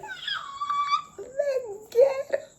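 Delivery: high squealing laugh through the words; panicked laugh. Real, not acted. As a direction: laugh helplessly while trying to speak.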